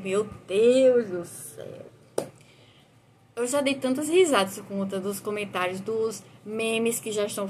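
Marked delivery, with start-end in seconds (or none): 0.0-1.8 shocked exclamation. Retold or imitated. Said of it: "você diz".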